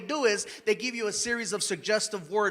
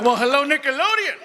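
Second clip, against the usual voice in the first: higher, playful come-on; comic flirting with a rival studio, playful and higher; flirty greeting; 4 semitones higher, 5 dB louder than usual. Retold or imitated. Flirty reply to new studio.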